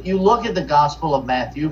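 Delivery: ordinary talk, nothing marked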